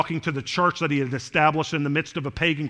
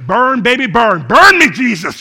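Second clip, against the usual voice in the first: louder, exuberant burn; playful fervent cry; 8 semitones higher, 14 dB louder than usual. Pretend. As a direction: shout it with joyful zeal.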